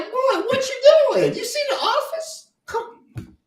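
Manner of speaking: very high, slow, laughing disbelief; incredulous disbelief. Retold or imitated. Imitating his own reaction to the coach leaving, high laughing disbelief. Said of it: "I was like".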